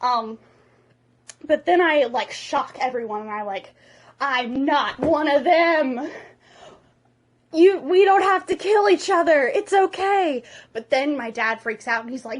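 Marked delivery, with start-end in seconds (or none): 4.2-10.4 loud, high, theatrical plea; hammed-up proclamation to a crowd; impassioned declaration. Hiccup shocks everyone at the dragon killing, impassioned declaration.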